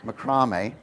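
"Macrame" said muh-CRAH-may; low "Macrame" with the stress on CRAH; long ah in the middle of "Macrame"; second-syllable macrame. Shown as a demonstration. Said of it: "I don't know if you call it".

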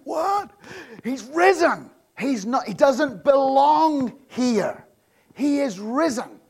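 high, loud astonished cry; women stunned at the empty tomb. Retold or imitated. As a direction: gasp it out in disbelief. Women's astonished reaction, acted.

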